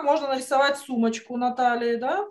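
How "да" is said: normal talking voice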